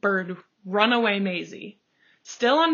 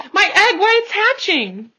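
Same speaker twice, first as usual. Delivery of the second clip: much higher and louder joyful cry; ecstatic shout; 11 semitones higher, 10 dB louder than usual. A narrator's reading. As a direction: yell it with delight. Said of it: "shouted Horton".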